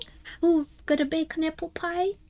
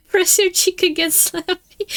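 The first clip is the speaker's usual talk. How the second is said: laughing; 6 semitones higher, 7 dB louder than usual. Real, not acted. Laughing while reading.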